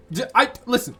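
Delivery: usual speaking voice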